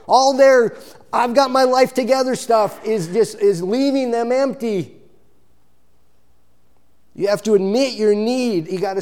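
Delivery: fervent preacher quote; preaching. Acted. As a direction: build it up with urgency.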